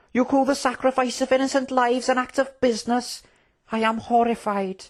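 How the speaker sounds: high, appalled princely protest; horrified indignation. Acted. Prince condemning the deal for war, horrified. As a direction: protest in high-pitched moral outrage. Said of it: "cried the prince".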